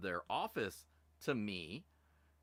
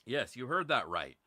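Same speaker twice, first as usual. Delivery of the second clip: ordinary delivery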